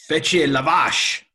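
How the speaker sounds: loud, high, drawn-out French call; hammed-up French cry; French knight's command. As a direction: shout the order like the film knight. Quotes French knight's shouted order.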